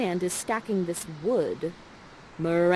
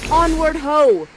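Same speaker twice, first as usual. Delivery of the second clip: louder and higher, a hammed-up captain's cry; rallying call; 7 semitones higher, 10 dB louder, slower than usual. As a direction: rouse the lords to march. Leading the group onward.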